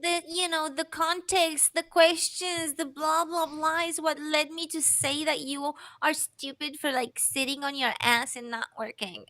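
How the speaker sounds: high, mocking impression of a celebrity excuse; mock interviewee voice. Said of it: "They were like".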